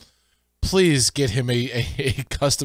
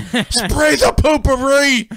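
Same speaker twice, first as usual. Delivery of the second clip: high, loud, drawn-out mock yell; yelling mother; 11 semitones higher, 5 dB louder, slower than usual. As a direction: imitate a mother hollering through the house.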